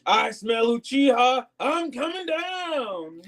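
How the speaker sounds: very high, very slow creepy villain drawl; hammed-up menacing villain. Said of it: "He's like".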